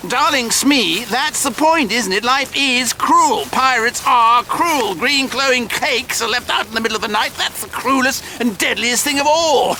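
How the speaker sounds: high-pitched, theatrical gloating speech; villainous relish. Acted. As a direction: declaim grandly, savouring every wicked word. Villain declaims on cruelty.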